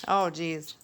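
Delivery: normal talking voice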